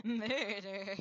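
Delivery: mimicking a hammed-up MURDER. Echoing the co-player's melodrama, hammed-up. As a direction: stretch the word out theatrically. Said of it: "not to ham that word up".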